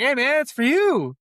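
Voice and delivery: high, loud call of hey man; mock caller. Retelling the movie to friends, loud high mock caller. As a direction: call it out like a bar regular.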